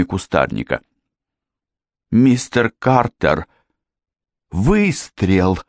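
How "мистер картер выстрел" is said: higher, drawn-out cry of alarm; lightly acted shocked girl, stretching the words; frightened babble. A narrator's reading. Janet reporting the shot to her father, babbling in fright. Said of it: "пролепетала Джанет".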